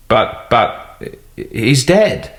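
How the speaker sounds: a hint of a shaken little clerk; stammering shock. A narrator's reading. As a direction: stutter it, rattled. Secretary stammers over the body.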